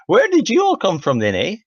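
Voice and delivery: put-on loud, high-pitched stab at a Canadian accent; botched Canadian-sounding accent. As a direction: call out in an accidental Canadian accent. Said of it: "that was not the right accent".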